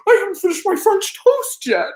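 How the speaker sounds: very high squeaky indignant whine; indignant old man. Retold or imitated. Old man protests he hasn't finished breakfast, squeaky whine. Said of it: "He goes like".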